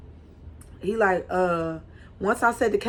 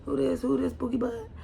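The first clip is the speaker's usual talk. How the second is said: hushed and higher, faking calm; calm asking; 5 semitones higher, 7 dB quieter than usual. Retold or imitated. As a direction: ask it softly, hiding the suspicion. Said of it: "I asked calm".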